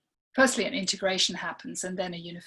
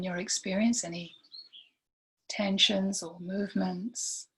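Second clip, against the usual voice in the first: guided meditation; 3 dB quieter than usual. Real, not acted. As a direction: lead gently through it, leaving long silences between phrases.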